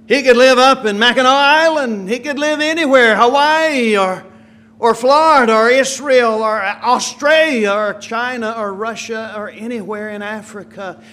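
high, loud, building list; rising preaching. Acted. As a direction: sweep across the whole world.

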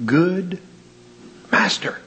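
"Good" then "master", each word set apart with its own weight; stress on good master. Shown as a demonstration. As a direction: lean on "Good" then "master" as two beats. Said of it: "What did he call him?".

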